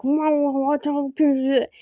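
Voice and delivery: a hint of a sulky teen; mock a teenager crossing their arms. Mocking a teenager refusing, lightly voiced sulk. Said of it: "when a teenager stomps their feet".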